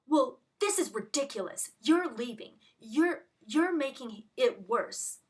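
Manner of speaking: retold scolding, loud and emphatic; angry mean nurse. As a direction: imitate an angry nurse telling someone off. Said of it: "this angry, tiny little evil, mean dir-, nurse".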